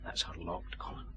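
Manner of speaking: very low, soft undertone; hushed whispering. Acted. Man reporting an unlocked door during a night search, hushed. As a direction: keep your voice right down. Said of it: "all whispering for".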